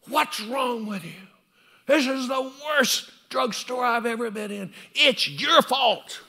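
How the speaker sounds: high, loud, slow furious complaint; angry rant. Retold or imitated. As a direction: bark at the young clerk. Angry customer yelling at clerk.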